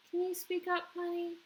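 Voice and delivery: lightly voiced teacher coaxing a shy boy. A narrator's reading. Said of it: "said Miss Potosa".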